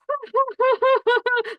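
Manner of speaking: high, loud cackling fit of laughter; imitating grandpa's hysterical laugh. Grandpa after beating granddaughter at chess, cackling wildly. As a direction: imitate grandpa cracking up uncontrollably. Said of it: "going into this fit of laughter".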